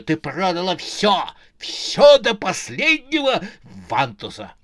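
aggrieved rant, punching out each charge; indignant baron. Acted. Baron accuses his murderous wife. (возмущённая тирада барона, до крика)

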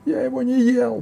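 high, loud panicked denial; крик людоеда. A narrator's reading. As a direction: shriek the denial as a cornered ogre. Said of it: "закричал людоед". (кричит высоким голосом)

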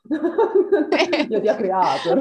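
laughing haughty. Pretend. Mock haughty reply, laughing.

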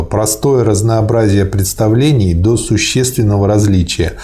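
ordinary delivery

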